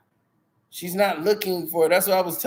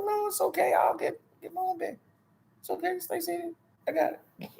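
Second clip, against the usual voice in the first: hushed polite refusal; 11 semitones higher, 7 dB quieter, faster than usual. Retold or imitated.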